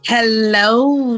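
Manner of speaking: usual speaking voice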